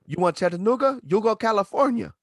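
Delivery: mock Arabic clerk voice; imitating the clerk. Clerk answering the lost wrestler, mock accent. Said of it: "He said".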